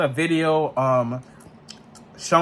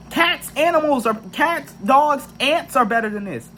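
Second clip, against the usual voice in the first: exasperated; 7 semitones higher than usual. Real, not acted. Ranting that people act like robots.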